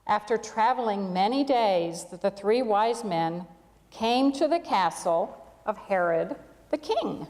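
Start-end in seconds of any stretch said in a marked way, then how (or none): none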